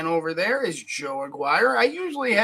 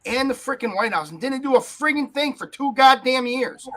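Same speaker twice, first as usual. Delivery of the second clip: rising, louder furious rant; angry rant; 5 semitones higher, 5 dB louder than usual. Real, not acted. Host rants to his co-host about gun laws, furious. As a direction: build to a real shout. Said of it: "And I'm pissed".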